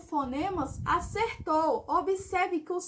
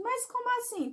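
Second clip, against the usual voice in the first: higher, puzzled "mas como assim?" as an imagined pupil; puzzled student; 7 semitones higher than usual. Pretend. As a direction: voice a confused student objecting. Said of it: "Aí você deve estar pensando".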